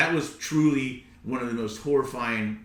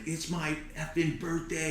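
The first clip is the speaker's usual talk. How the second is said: high-pitched mock yell, held back in volume; retold angry yell; 5 semitones higher, 6 dB quieter than usual. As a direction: act out yelling at a shark. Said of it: "started yelling and screaming at him".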